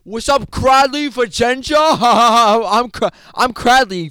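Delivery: loud high swaggering voice; cocky bully. Acted. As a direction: strut in, slow and loud. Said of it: "His name is Cradley".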